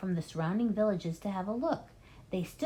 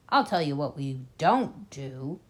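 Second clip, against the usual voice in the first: low grim voice; 4 semitones lower than usual. Acted. Bean voiced lower.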